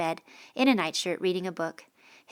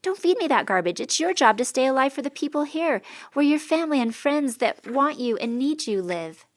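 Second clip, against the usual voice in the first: loud, raised, higher pleading scold; heated, louder and higher; angry retort; 7 semitones higher, 6 dB louder than usual. Acted. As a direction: push back hard on him. Heated argument over staying alive.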